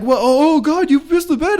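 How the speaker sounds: high, shrieking mock girlfriend voice; shocked girlfriend. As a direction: squeal like a girl who just felt the wet sheets. Quoting the girlfriend discovering the wet bed.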